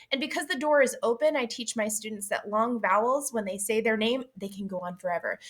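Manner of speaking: normal talking voice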